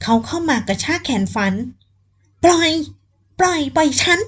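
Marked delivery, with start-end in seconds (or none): 2.0-4.2 frantic yelling. Acted. Captive woman screaming at the man to let her go, high frantic yell. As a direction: yell to be let go while thrashing. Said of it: "ฝันโวยวาย".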